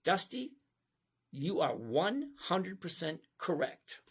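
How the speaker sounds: slow, deliberate, every word spaced; said slowly. Shown as a demonstration. Streamer slowly tells viewer Dusty he is right, deliberately slow. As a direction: spell it out slowly so it lands first time. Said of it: "I'm gonna say this slow so you get this".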